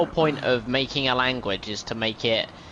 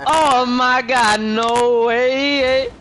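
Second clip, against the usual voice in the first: much higher and louder, stretched out in disbelief; shocked outcry; 9 semitones higher, 7 dB louder, much slower than usual. Real, not acted. Friend reacts to ramen lice joke with a stunned yell. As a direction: yell it like you can't believe what you heard.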